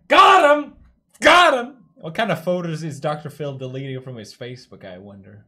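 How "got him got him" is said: high, loud triumphant shouts; two punchy whoops, very loud; gleeful cheer. Pretend. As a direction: shout it like scoring a hit. Cheering a burn in clip.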